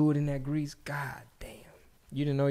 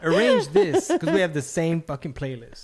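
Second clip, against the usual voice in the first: mock driver calling mom; 5 semitones higher, 5 dB louder than usual. Husband mocking the driver calling home.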